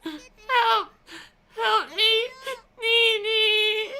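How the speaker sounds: begging through tears, high and loud; crying for help. A narrator's reading. Little girl sobbing for her brother. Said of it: "started begging through her tears".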